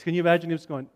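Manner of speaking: ordinary talk, nothing marked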